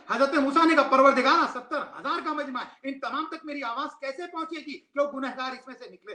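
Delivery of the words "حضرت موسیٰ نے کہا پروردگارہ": loud preacher's cry. Real, not acted. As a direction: call out to the congregation at full force. Preacher cries out to the congregation. (بلند آواز میں پکار)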